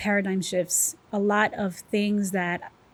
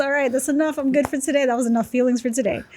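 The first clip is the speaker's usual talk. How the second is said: mock cutting off; 5 semitones higher, 5 dB louder, much faster than usual. Acts out calling time on feelings.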